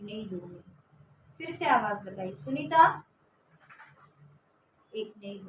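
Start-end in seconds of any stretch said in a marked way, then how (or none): 2.3-3.0 louder call. Retold or imitated. Calling the sister a second time, louder. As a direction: call her name again, louder and more urgent.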